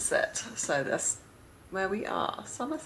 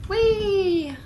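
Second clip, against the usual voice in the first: gleeful whee; 14 semitones higher, 4 dB louder than usual. Real, not acted. Seeds fly out in game.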